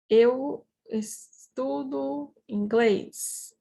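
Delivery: slow, with gaps between the words. Shown as a demonstration. Teacher models the sentence for students, slowly word by word.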